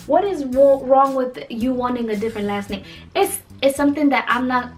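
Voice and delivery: ordinary talk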